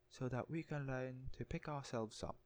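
a hint of an English butler; Alfred's English accent. Alfred answering his own question, English accent. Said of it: "he replies to himself saying".

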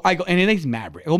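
usual speaking voice

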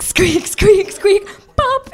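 rising high squeaks ending in a pop; imitated squeak and pop. Retelling the haunted bulb, rising high squeaks then a pop. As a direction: mimic the bulb screwing in and popping on.

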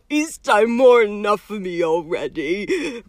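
loud, anguished groan. A narrator's reading. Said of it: "groaned Hagrid".

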